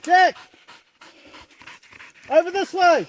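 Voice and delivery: loud high shout for a mate; calling out. Real, not acted. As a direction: holler his name across the scrub.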